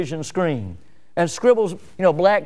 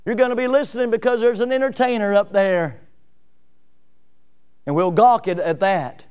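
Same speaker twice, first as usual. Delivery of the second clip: ordinary talk, nothing marked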